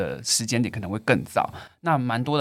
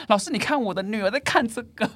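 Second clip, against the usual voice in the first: high, urgent imitation of a frantic mother; loud panicked mother; 10 semitones higher than usual. Panicked mother showing the teacher the phone, high and urgent. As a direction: play the alarmed mom bursting into class, pitched up. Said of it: "她声音非常的大声".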